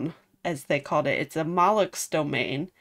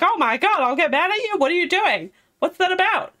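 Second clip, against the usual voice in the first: much higher, louder mock outrage; indignant Velvet; 11 semitones higher, 4 dB louder, faster than usual. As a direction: fire back in exaggerated disbelief. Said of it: "Velvet's all like".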